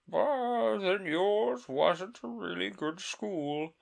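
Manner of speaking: high, slow, relieved sigh. Acted. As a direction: breathe out relief. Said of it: "in a tone of great relief".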